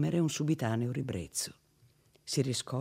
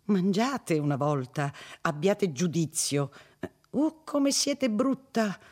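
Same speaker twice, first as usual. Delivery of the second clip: petulant pleading; 7 semitones higher, 5 dB louder than usual. A narrator's reading. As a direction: nag and beg her to eat, then sulk. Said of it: "sempre con un tono di supplicazione stizzosa".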